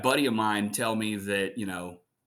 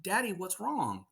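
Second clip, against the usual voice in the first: put-on higher, softer child voice; little daughter; 9 semitones higher, 5 dB quieter than usual. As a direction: ask like a worried little girl. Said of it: "my daughter turned and looked at me and said".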